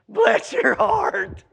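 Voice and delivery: high, slow, laughing Southern drawl on "Bless your heart". Shown as a demonstration. Preacher showing the congregation the Southern phrase, laughing Southern drawl. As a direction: put on a sweet Southern lady, chuckling. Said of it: "Southern style".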